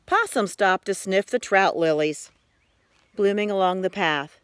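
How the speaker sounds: ordinary delivery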